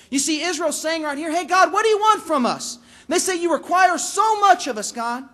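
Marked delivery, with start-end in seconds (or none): 1.3-2.8 high, loud, exasperated demand; acted indignant outburst; sarcastic exaggeration. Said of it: "speaks is so sarcastic to God". 3.4-5.3 high, loud protest dropping at the end; acted indignant whine to God; exaggerated complaint. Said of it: "And the exaggeration begins".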